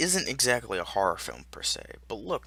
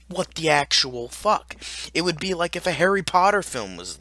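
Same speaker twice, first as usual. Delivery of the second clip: loud, raised-pitch disbelief; exasperated rant; 7 semitones higher, 6 dB louder than usual. Real, not acted. Exasperated rant about short runtime.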